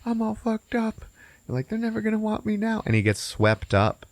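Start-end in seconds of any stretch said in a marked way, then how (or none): 0.0-2.8 high, whiny despairing character voice; mock distress. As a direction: lament your ruin in a squeezed high voice. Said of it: "he's like".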